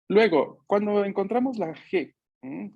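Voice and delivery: ordinary talk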